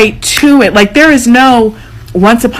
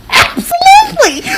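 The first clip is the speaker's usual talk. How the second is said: very high, slow, drawn-out squeal of agreement; emphatic approval. Retold or imitated. Agreeing with the fight-back verse, a very high slow squeal. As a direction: stretch it out like an overjoyed yes. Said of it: "And I was like".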